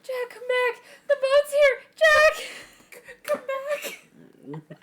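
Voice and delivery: high-pitched, tearful movie cry; mock desperate plea. Vet calling to a lost vein, mock desperate pleading. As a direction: call after someone in hammed-up despair. Acting out movie line at work.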